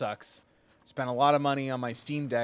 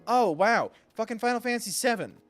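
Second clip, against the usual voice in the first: excited; 8 semitones higher, 5 dB louder than usual. Retold or imitated.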